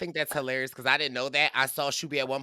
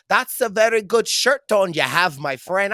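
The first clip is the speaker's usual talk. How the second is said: higher, louder mock Irish lilt; imitated Irish accent; 4 semitones higher, 6 dB louder than usual. Host mimics an Irishman at a party, playful Irish accent. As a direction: put on a friendly Irish accent. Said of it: "he was like".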